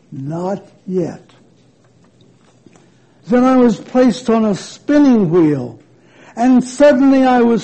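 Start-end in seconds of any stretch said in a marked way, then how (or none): 0.1-1.3 soft, low and unhurried; quiet calm reply. A narrator's reading. Master calmly refusing the teacup's plea. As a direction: say it gently, with a patient smile. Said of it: "But he only smiled".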